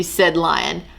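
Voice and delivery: usual speaking voice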